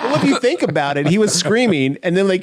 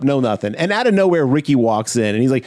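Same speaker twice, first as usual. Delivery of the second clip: ordinary talk, nothing marked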